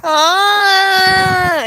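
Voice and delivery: a sudden high wailing cry; mock the wail of a kid bursting into tears; high imitated crying yelp. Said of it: "then he just start crying".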